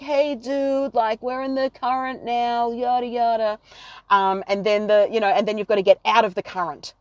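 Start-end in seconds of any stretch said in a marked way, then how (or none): 0.0-3.6 higher, lightly voiced laid-back surfer dude; a hint of the chill sea turtle from Nemo. Explaining staying in the current, lightly voiced Nemo surfer turtle. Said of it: "in Nemo when, when the turtles are there and it's like".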